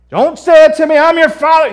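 very loud, high-pitched angry outburst; outraged father shouting. Acted. Jacob refusing to hear his son Judah, outraged shout. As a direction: shout him down as an offended father.

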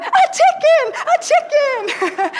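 very high, slow, loud and laughing; echoing the stranger's scream through laughter; laughing shout. Retold or imitated. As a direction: shout it back while cracking up. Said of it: "And I'm going".

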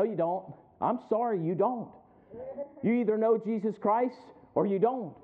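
ordinary talk

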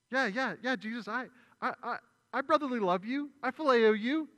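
high, loud, tumbling rush of yeahs; flustered insistence. Retold or imitated. Peter flustered, insisting to Jesus he loves him. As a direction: blurt it out eagerly, tripping over the words.

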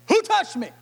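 high sharp call of who touched me, with Who loudest; Jesus spins round asking the crowd, high and urgent. Retold or imitated. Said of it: "and said".

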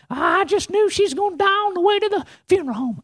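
very high, wailing granny; grandmother's voice. Acted. Preacher imitating grandmother at the funeral home, high wail. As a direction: imitate a fretting grandma far up in pitch. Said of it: "she's just talking about".

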